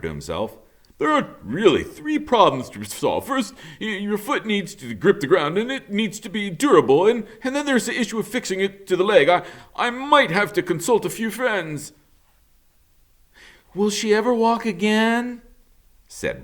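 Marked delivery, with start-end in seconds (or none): 1.0-11.9 put-on high-pitched, lively old beaver voice; a busy beaver thinking aloud; eager beaver. Mister Beaver puzzling over the robot's foot, high-pitched and lively. 13.7-15.4 worried question. Acted. Worried question from Bright Bill.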